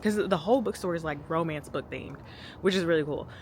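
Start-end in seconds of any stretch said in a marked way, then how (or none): none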